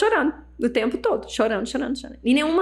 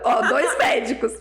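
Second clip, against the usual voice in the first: ordinary talk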